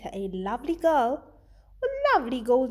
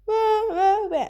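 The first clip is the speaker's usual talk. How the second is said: hammed-up baby wail, louder and higher; mock crying; 8 semitones higher, 5 dB louder than usual. Teacher playing Baby Bear crying, a hammed-up wail. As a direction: wail like a toddler.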